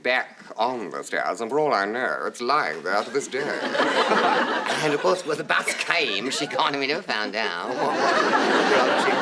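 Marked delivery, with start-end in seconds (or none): 3.5-4.8 high wheezy giggle; real amused laugh; breathy laugh. Real, not acted. Laughing at own story. 8.0-9.1 words lost in a high laugh; real laughter breaking the words. Real, not acted. Laughing while telling.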